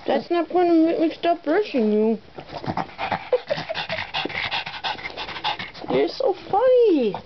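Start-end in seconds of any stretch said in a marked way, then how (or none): 2.2-5.9 soft high airy giggle at the dog; a quiet amused laugh, no voice; breathy laugh. Real, not acted. Laughing at the puppy.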